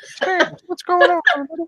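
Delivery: high mocking voice of Corey; squeaky mock impression of Corey; imitating Corey. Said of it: "your co-host will take over".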